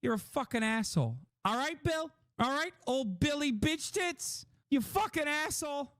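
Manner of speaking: high, hammed-up insults; mock Burr rant. Streamer imitating the comedian ranting at Bill, hammed-up. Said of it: "but even he's like".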